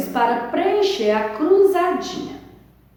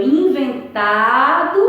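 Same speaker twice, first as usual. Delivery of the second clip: "inventado" with a lengthened TA; "inventado", its TA held and lifted; stress on TA; 3 semitones higher, 4 dB louder, much slower than usual. Shown as a demonstration. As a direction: push the TA out in "inventado". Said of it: "sílaba tônica é o tá".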